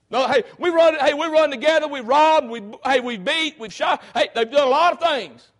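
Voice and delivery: loud, high boasting thief on the cross; a hanging thief owning up loudly to his robberies; voiced thief. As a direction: blurt out his crimes like a brash outlaw. Preacher voices thief boasting crimes.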